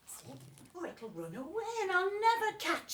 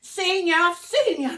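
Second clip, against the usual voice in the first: gleeful shout; 2 semitones higher, 14 dB louder than usual. Acted. Hunting a creepy crawly.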